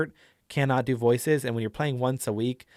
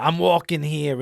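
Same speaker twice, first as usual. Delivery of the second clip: put-on louder New York street accent; brash New York accent; 3 semitones higher, 6 dB louder than usual. Showing players a simple go-to voice, brash New York accent. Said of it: "the best you can do is".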